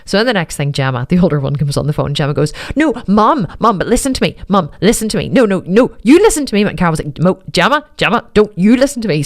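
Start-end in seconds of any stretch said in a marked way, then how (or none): 2.8-6.6 higher and louder, insisting to be heard; insistent. Retold or imitated. Older daughter insisting her mother listen, rising to a louder cry. 7.2-9.2 firm mother snapping back; stern retort. Retold or imitated. Mother snapping back at her daughter, stern.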